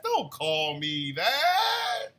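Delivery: playful outraged shout at the chat; protesting. Real, not acted. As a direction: stretch it out, don't call me that. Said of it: "D T over in the TikTok chats".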